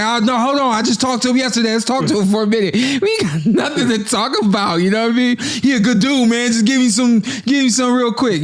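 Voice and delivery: high, laughing impression of an eager friend; imitating Kenny. Friend mimics Kenny eagerly stopping for the shoe shiners, high and laughing. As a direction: pitch it way up and chuckle through it. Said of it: "He's like".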